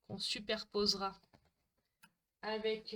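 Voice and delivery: usual speaking voice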